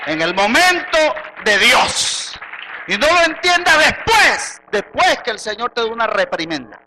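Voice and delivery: loud preaching with punched phrases; prédica a toda voz. Real, not acted. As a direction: drive each phrase home forcefully. (predicación enérgica, en voz alta)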